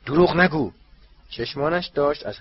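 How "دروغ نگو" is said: high, loud accusation; angry shout. A narrator's reading. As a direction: bark it out in fury. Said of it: "فریاد زد". (فریاد عصبانی)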